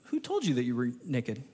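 low, soft, tender question; God gently asking the hiding man; gentle God. Acted. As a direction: ask it gently like a sad father.

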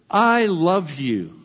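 slow and lingering, each word given its weight; tender declaration. Shown as a demonstration. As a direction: say it gently, as if you truly mean it. Preacher models a tender declaration.

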